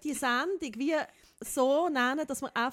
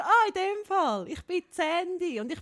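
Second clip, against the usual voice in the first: higher and louder, eager copycat voice; imitating friend; 5 semitones higher, 5 dB louder than usual. Friend imitating the Sandy trick, higher and louder. Friend copies the made-up persona.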